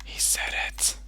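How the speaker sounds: voiceless conspiratorial whisper; whispered tattling. Pretend. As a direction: whisper it behind the other's back. Whispers back to the stream.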